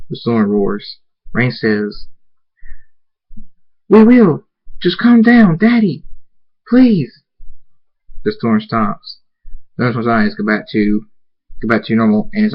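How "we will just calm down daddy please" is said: high, loud pleading wail; desperate begging. Acted. Daughter begs her father as the storm roars, desperate high plea.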